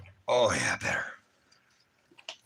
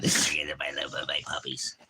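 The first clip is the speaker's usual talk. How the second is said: whisper; 6 semitones lower than usual. Real, not acted. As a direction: whisper it tenderly to the puppies. Whispering love to his dogs.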